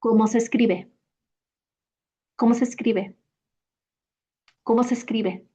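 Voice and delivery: quick native "¿Cómo se escribe?" with the vowels blended; fluent native question; natural speed. Shown as a demonstration. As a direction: fire it off casually. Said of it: "I'll say it at natural speed".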